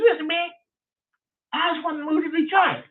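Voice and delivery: high squeaky voice for the caller; Leonard's voice. Retold or imitated. Retelling the strange caller, high mimicking voice. Caller asks about Detroit.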